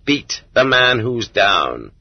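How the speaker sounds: slow, weighted quote of a brutal rule; grim motto. Retold or imitated. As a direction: lay out each word like a cold maxim. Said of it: "The ethics of our prison chiefs are".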